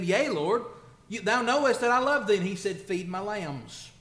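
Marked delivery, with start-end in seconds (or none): 0.0-2.4 loud, high, pleading declaration; fervent plea. Acted. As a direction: cry it out as Peter begging. Peter's plea voiced in sermon.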